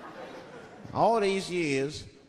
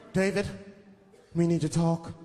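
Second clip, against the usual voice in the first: mock girlfriend. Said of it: "I have to do what I have to do".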